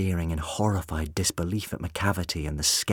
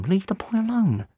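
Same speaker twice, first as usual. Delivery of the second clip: much higher, urgent hiss; 14 semitones higher, 3 dB louder than usual. A narrator's reading. Rose warns the doctor off the boy, hissed. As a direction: warn him off sharply under your breath. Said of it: "Rose hissed at him".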